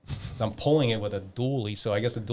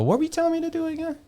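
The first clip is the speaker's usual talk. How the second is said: much higher, fast, sing-song playing dumb; mock innocent question; 3 dB louder, faster than usual. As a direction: ask like you honestly forgot, in a high fast voice. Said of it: "I go".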